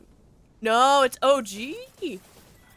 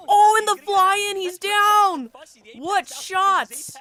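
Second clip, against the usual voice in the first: excited shout; 9 semitones higher, 8 dB louder, much slower than usual. Real, not acted. Caster calling a knockdown.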